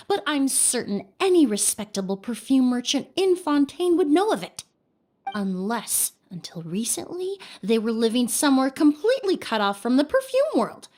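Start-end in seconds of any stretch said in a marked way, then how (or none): 5.4-7.4 hushed, breathy and knowing; sly whisper. Acted. Detective hinting at prison in a sly whisper. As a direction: drop it to a whisper like a secret.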